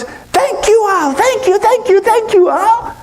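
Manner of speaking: very high excited squeal; grateful monkey. Acted. Monkey with all the nuts thanks owl, gushing squeal.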